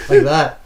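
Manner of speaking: high loud yelping laugh; shrieking laugh. Retold or imitated. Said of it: "I was like".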